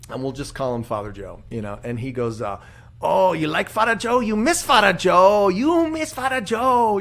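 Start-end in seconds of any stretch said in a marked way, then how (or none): 3.0-7.0 put-on Filipino priest accent, much higher and louder, drawn out slow; hammed-up Filipino priest teasing the congregation. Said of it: "Dude, your accents".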